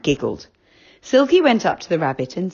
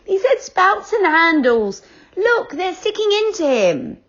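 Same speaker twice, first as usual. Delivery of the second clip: put-on very high, loud girl's voice, laughing then scolding; a girl giggling and telling them off; little girl character, high and merry; Franny laughing; 13 semitones higher, 4 dB louder than usual. A narrator's reading.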